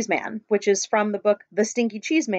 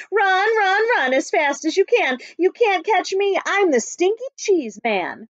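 higher, cocky sing-song taunt of a runaway cheese man; taunting call; 8 semitones higher, 3 dB louder, slower than usual. Acted. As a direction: call it out teasing, slowing on the last lines.

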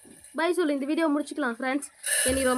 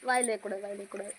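quiet scolding; 5 semitones lower, 9 dB quieter, faster than usual. Real, not acted. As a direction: hush it down, firm but soft. Mother scolding child gently.

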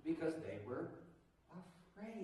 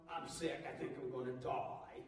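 soft, lower, self-pitying moan; whiny sick complaint; 6 semitones lower, 5 dB quieter than usual. Retold or imitated. Pastor imitating a person who says they will die. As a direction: sulk like a hypochondriac. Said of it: "And, you know".

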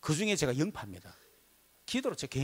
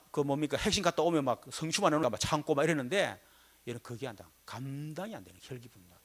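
usual speaking voice

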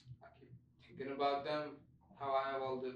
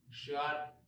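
usual speaking voice

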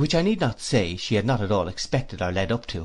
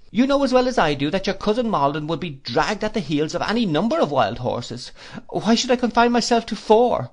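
put-on high, fluttery elderly mother; fussy old lady; 6 semitones higher than usual. A narrator's reading. Old lady voiced in story.

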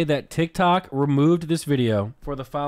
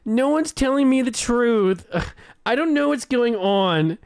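high, loud, drawn-out whine; mock a complaining heroine, slow and high; Elizabeth; 7 semitones higher, 5 dB louder than usual. Annoyed viewer mimicking a Blacklist character, exaggerated whining. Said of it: "She's like".

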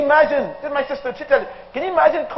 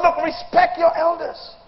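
slow preaching quote; 4 semitones higher, slower than usual. Acted. Voicing God slowly.

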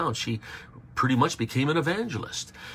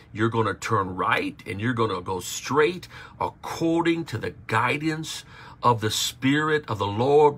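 normal talking voice

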